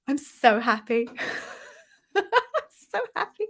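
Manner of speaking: happy tears breaking through; teary happiness. Real, not acted. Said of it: "I'm a little bit teary".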